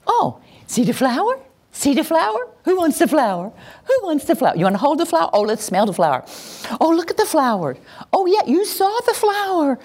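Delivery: put-on high singsong baby talk to an infant; showing parents how to talk to a baby. Shown as a demonstration. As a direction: coo at a baby about a flower. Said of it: "here's what we do with little babies".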